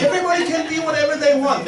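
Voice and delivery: ordinary talk, nothing marked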